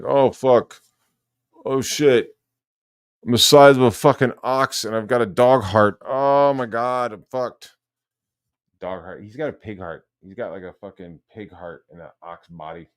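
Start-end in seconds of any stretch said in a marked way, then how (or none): 0.0-7.8 loud imitation of someone panicking; mock panic. Streamer mimicking his rival fearing a heart attack, mock panic. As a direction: play up a heart attack scare, loudly. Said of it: "First thing you thought was a heart attack".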